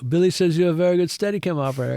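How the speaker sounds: quick line with a hint of Swiss accent. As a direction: lightly imitate a Swiss accent. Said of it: "with his Swiss accent".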